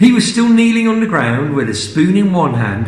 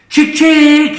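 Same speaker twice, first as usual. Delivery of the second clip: very high, louder chick call; calling hens; 12 semitones higher, 6 dB louder than usual. Acted. George calling the hen to the spoon, high coaxing call. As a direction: coax the hens in a high sing-song. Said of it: "he said".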